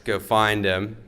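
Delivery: ordinary delivery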